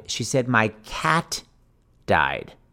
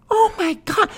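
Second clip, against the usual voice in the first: very high gasped oh my God; high mock-horrified oh my God; shocked gasp. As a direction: squeal out your shock. Shocked sympathy.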